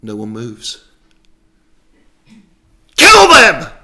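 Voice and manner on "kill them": all-out high, furious roar; louder roar. Acted. Saul roars at goons who won't move, louder roar. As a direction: roar the order at the top of your voice. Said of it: "he roars louder".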